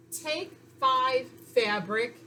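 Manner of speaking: "Take five fabric" read very slowly; teacher slowly models the sentence. Shown as a demonstration. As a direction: sound the words out one by one. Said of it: "reading the sentence word by word".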